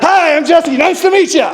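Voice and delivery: high-pitched, overeager hello; overeager greeting. Retold or imitated. Hiker jumping out at a startled family, overeager and high.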